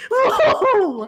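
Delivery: high loud laughing whoa; startled laugh. Real, not acted. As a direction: laugh off the shock. Jump scare reaction.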